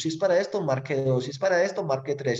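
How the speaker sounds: high, sing-song automated menu prompts; phone menu voice. Retold or imitated. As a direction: read the options like a recorded phone menu. Imitating automated phone menu options.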